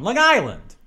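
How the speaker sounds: "Long Island" as one word, stress on "Island"; local Long Island. Shown as a demonstration. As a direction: slide "Long" into "Island" and lean on "Island". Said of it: "not the long".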